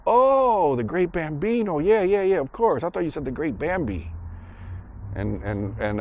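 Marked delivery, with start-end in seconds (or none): 0.1-4.1 high-pitched pretend "of course I knew"; mock dawning recognition in a high voice; faking recognition. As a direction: imitate a kid bluffing, pitch swooping up. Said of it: "he tries to fake it".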